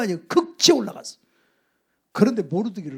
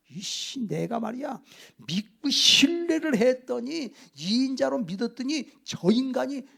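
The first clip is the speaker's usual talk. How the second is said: retold royal fury; 6 semitones higher than usual. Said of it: "막 고함을 막 질러대는 거예요".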